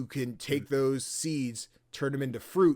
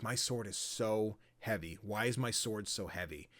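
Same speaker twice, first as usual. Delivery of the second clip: worn out; 4 semitones lower, 6 dB quieter than usual. Retold or imitated. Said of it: "you, you pass out".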